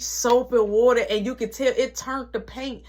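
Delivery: ordinary talk, nothing marked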